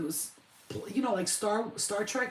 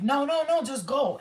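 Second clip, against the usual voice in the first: raised, louder acted protest; urgent insistence; 6 semitones higher, 6 dB louder than usual. Telling them not to stop, urgent insistence. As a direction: insist hurriedly, pitched up. Retold urging them to continue.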